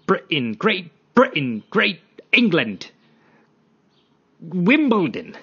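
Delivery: high, loud, drawn-out hammed-up English accent; mock English accent. Podcaster parodying English people of today, mock English accent. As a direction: swoop up into a silly British accent.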